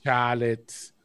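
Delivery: put-on low Australian-accented "Charlotte" with no R; Rhea Ripley. Podcaster repeats the wrestler's Charlotte for his co-hosts, Australian accent. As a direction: mimic an Australian saying "Chah-lit" for "Charlotte". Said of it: "there was certain words that her accent came out".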